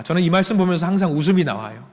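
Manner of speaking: ordinary talk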